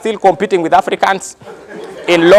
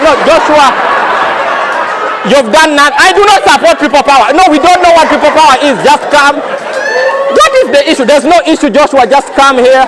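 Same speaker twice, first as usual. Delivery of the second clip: higher, louder urgent coaxing; coaxing officials; 6 semitones higher, 3 dB louder than usual. Acted. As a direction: hurry him over anxiously. Officials coaxing scared runner.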